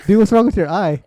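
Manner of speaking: loud, high startled question; acted-out alarmed outburst; retold alarm. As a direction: react in shock to a friend's face. Friends react alarmed to his eye.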